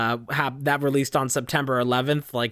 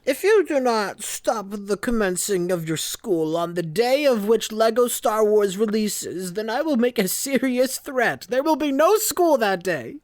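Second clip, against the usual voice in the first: high, slow pompous threat voice, rising higher as it goes; mock villain threat; 8 semitones higher than usual. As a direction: ham up a grand ultimatum. Mock threat about game release.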